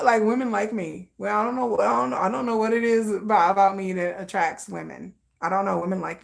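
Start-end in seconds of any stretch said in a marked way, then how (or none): none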